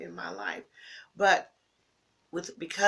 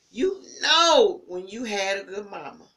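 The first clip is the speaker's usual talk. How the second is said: emphatic; 2 semitones higher, 9 dB louder than usual. Real, not acted. Repeats line, softer then louder.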